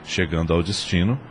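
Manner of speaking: ordinary talk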